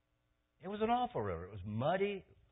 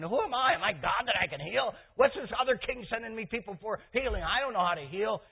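loud, high, exasperated outburst; acted royal frustration, pitched high and loud; frustrated king; 10 semitones higher, 8 dB louder than usual. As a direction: protest at full voice, thoroughly put upon. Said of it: "he's all frustrated".

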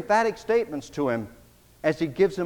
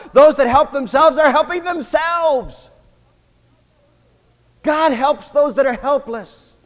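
full-force preaching; 8 semitones higher, 8 dB louder than usual. Acted. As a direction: thunder it out from the pulpit, word by word.

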